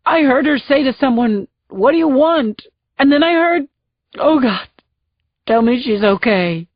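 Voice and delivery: high, tearful, desperate telling; sobbing. Acted. Husband telling the sergeant about the call, sobbing. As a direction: pour it out through tears.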